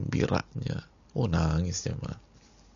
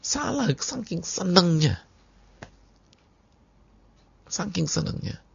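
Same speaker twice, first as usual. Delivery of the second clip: much higher, excited voice; overjoyed preaching; 10 semitones higher than usual. Acted. Preacher marvelling to the congregation, high and excited. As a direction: let the joy lift the voice sky-high. Explaining the joyful slip.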